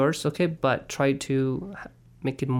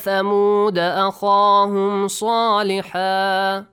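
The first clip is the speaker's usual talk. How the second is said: Quran recitation; 6 semitones higher, 5 dB louder than usual. Acted. Leads recitation.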